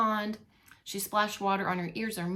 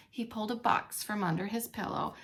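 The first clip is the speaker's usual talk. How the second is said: plain reading voice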